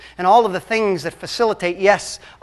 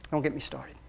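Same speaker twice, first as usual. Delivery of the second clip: softer, said half to himself; a quiet self-directed grumble; muttered aside; 8 semitones lower, 19 dB quieter than usual. Real, not acted. Pastor warning himself about screens, quiet aside. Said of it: "Speaking to myself".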